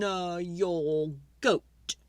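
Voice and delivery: put-on slow, measured old man's advice; slow wise old man. Wise old man advising the lady, slow and measured. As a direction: draw it out like a sage.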